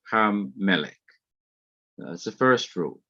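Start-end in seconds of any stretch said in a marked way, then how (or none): 0.1-1.0 "ham melek" with the m held double across the join; doubled consonant. Shown as a demonstration. Teacher demonstrating the first rule, "ham melek" with a doubled m. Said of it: "followed by dagesh forte".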